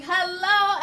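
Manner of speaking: ordinary delivery, nothing marked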